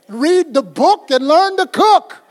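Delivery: emphatic exhortation. Real, not acted. Emphatic punchline advice to congregation.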